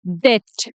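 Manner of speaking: डेट said "det", nothing before the t; teacher's model डेट, rhyming with "get"; correct silent-b "debt". Shown as a demonstration. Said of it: "इसका सही pronunciation है".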